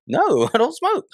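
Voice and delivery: high-pitched protesting denial; mock innocent denial. Son answers mom's questioning about smoking, high mock denial. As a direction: deny it in a squeaky-high retold voice.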